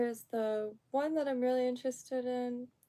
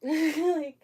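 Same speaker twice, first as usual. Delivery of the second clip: laughing; 5 semitones higher, 9 dB louder than usual. Real, not acted. Laughs at her own plan.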